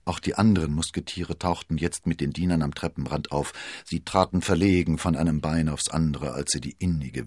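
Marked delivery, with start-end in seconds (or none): none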